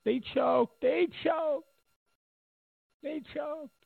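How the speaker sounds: high sneering singsong, repeated; mock taunt in a high voice; mocking Mika Brzezinski. Mimicking Mika Brzezinski taunting, in a high mock voice. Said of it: "Remember Mika Brzezinski?".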